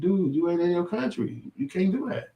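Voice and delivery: very high incredulous voice; mock reply. Answering the sketch character, very high and incredulous. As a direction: fire back high and incredulous. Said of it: "And it's like".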